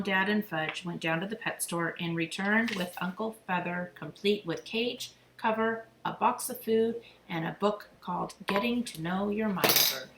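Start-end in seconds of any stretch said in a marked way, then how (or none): none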